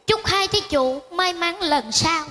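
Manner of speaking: slow, stretched-out blessing; mock monk. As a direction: bestow good wishes with priestly solemnity. Seller blesses buyers like a monk.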